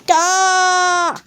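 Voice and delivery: a sudden fright cry; shocked gah; startled gah. Acted. Character startled.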